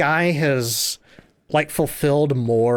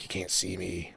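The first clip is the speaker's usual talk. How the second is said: muttered catchphrase; 6 semitones lower, 13 dB quieter than usual. Retold or imitated. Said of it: "he's like".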